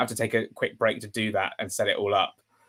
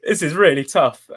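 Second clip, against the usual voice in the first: higher and louder, voice strained by real emotion; raised, tight, really touched; emotional; 4 semitones higher, 8 dB louder than usual. Real, not acted. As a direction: push through being moved. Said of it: "get emotional on stream".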